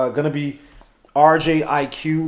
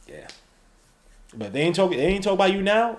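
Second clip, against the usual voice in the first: ordinary talk, nothing marked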